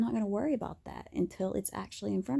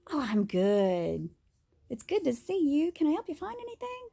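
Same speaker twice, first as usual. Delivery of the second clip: high, sing-song customer-service voice; fake cheerful shop clerk; 7 semitones higher than usual. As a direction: mask the tears with a chirpy clerk act. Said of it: "and I'd be like".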